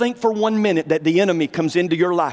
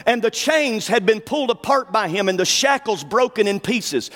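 ordinary talk

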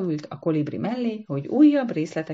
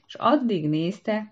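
plain reading voice

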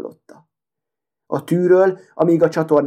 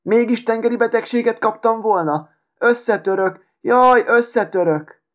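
indignant outcry; 7 semitones higher than usual. A narrator's reading. The needle crushed under a cart wheel cries out, indignant. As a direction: wail it in high-pitched affront.

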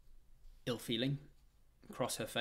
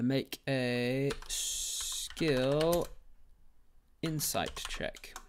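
very slow, stretched words; long, lingering syllables; drawn out; 4 semitones higher, 6 dB louder, much slower than usual. Real, not acted. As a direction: stall on each word while setting up a roll. Drawls out while making the roll.

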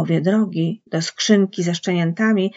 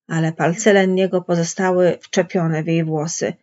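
ordinary delivery

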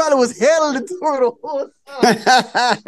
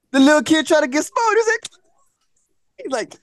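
ordinary talk

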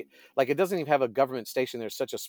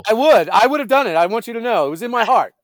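loud, high, hammed-up sincerity; mock earnest; 11 semitones higher, 13 dB louder than usual. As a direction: overblown heartfelt pledge, big and loud. Mock heartfelt declaration after joke.

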